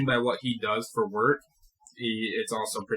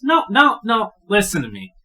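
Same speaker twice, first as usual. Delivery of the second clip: higher, louder insistent no; cutting him off; 10 semitones higher, 9 dB louder than usual. Acted. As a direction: stop him mid-sentence with force. Franklin cuts Samson off.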